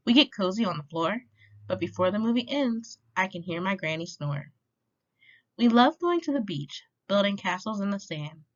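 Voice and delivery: usual speaking voice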